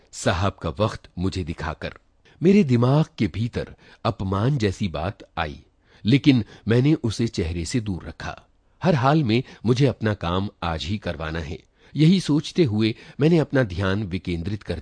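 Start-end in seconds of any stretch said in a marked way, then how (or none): none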